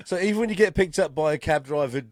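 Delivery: normal talking voice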